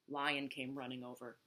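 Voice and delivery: ordinary delivery, nothing marked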